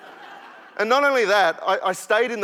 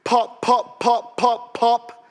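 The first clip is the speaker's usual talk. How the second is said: higher, louder punchy pops; popping sounds; 7 semitones higher, 6 dB louder, much slower than usual. Acted. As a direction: pop each word like cereal crackling. Mimicking cereal popping sounds.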